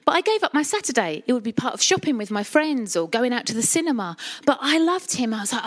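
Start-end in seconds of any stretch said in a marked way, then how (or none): none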